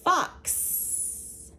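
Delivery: Fox whispered high, x hissed at the end; whispered Fox in a phonics lesson; whispered word. Shown as a demonstration. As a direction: breathe Fox softly, leaning on the x. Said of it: "box and fox".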